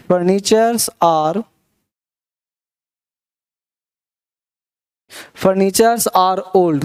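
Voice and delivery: wrong plural read out very slowly; slow demo of a wrong sentence; slow wrong example. Shown as a demonstration. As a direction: dictate the mistake word by word. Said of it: "तो क्या हो जाएगा बेटा? गलत हो जाएगा।".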